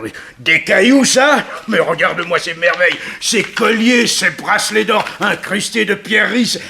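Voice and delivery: high, loud, giddy marvelling; a thrilled villain admiring stolen necklaces; greedy delight. Acted.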